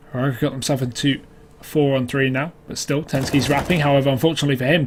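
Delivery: ordinary talk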